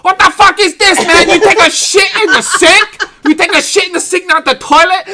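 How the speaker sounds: put-on outraged high-pitched accented shouting. Manager confronting guests about the sink, screamed in mock accent. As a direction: scream it as an appalled hotelier, hammed up.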